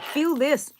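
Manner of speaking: lightly voiced yarn snob urging a touch; playful imitation of a picky knitter; mock fancy knitter. Teasing her knitter friends, mock fancy feel-this. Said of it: "some people are more like".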